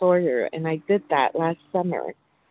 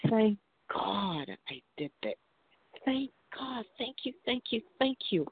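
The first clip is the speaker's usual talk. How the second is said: much softer and higher, a near-whispered thank you; relieved gratitude; 5 semitones higher, 11 dB quieter than usual. Retold or imitated. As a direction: breathe it out like a prayer of relief. Retold overwhelming relief and gratitude.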